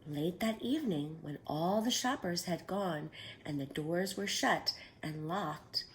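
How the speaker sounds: ordinary delivery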